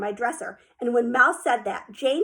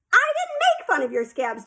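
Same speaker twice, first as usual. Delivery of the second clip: hollered; 9 semitones higher than usual. A narrator's reading. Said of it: "Jane hollered".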